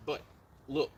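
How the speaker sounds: ordinary delivery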